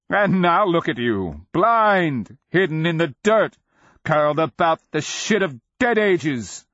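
loud, high, scornful shout; shouted taunt. A narrator's reading. Cleric mocking the blind dragon, shouting. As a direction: hurl it high and loud as a jeer.

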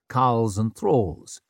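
ordinary delivery

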